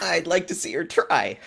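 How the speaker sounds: high, loud, defiant retort; a candidate defying her rival's boast; defiant challenge. Acted. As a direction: throw the challenge right back. Player voices defiant candidate.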